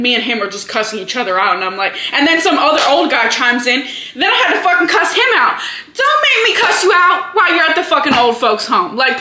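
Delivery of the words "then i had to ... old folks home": hyped-up anger. Real, not acted. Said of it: "I'm so hyped up and angry".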